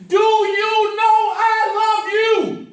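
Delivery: slow, loud, high, every word hit; every word emphasized. Shown as a demonstration. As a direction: shout "Do you know I love you?" word by word. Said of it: "put a great emphasis on every single word".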